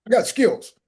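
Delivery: loud, high, incredulous protest; indignant scoffing. Retold or imitated. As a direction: scoff like your pride is stung. Retold scoffing reply to mentor.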